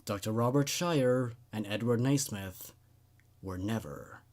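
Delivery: low, hushed horror-story reading; quiet spooky narration. A narrator's reading. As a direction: read it like a creepy tale told at night. Reads a horror story aloud.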